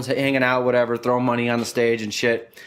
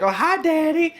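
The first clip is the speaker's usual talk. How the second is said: put-on much higher, cooing girly tone; slower than usual.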